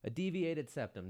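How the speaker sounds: lifted, marvelling at the words; incredulous echo. Real, not acted. Host echoing the doctor's diagnosis, lifted incredulous echo. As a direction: say it like the words are alien.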